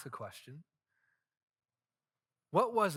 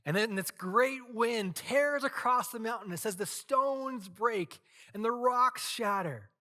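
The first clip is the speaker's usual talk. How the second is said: louder and higher, the wind tearing through; dramatic narration; 8 semitones higher, 5 dB louder than usual. A narrator's reading. Preacher telling the congregation of the wind, loud and high. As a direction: build it like a storm.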